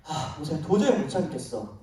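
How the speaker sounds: God murmuring his patience is gone; weary exasperation. Retold or imitated. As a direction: at the end of patience, quietly. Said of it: "너무 화가 나서".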